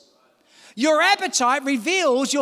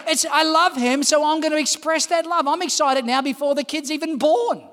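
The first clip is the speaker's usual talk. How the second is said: ordinary talk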